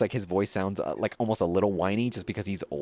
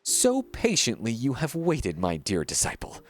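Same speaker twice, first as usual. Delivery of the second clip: theatrical, self-important painter with sweeping pitch swings; pompous art master. Acted. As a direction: declaim like a grandiose mentor to a disciple. Reads a pompous painter's game dialogue.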